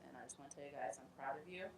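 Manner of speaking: usual speaking voice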